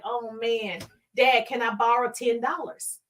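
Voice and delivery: ordinary delivery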